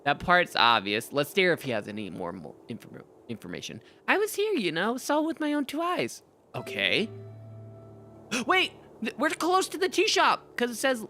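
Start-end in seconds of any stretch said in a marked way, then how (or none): 4.1-6.2 put-on high, boastful kid; higher kid voice. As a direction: kid swearing he saw it.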